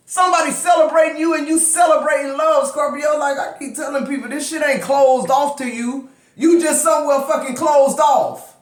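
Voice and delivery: fired-up yelling. Real, not acted. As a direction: shout it so they can finally hear. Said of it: "Scorpio know why I'm yelling".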